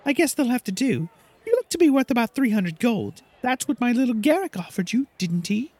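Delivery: haughty Silky voice. Acted. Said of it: "So Silky says".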